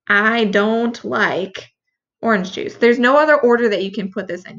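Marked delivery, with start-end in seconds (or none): 0.1-2.8 "I don't like" stretched very slowly; slow down to show the meaning of each word; slow model. Shown as a demonstration. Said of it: "So no".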